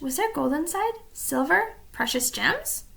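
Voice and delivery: plain reading voice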